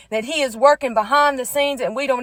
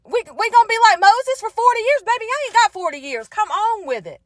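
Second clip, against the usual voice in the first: mock frustrated yell; 8 semitones higher than usual. Woman tired of waiting on God, imitating her frustrated yelling. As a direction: push it up high, hammed-up impatience. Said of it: "I've yelled and screamed in rooms".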